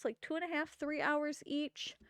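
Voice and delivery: ordinary delivery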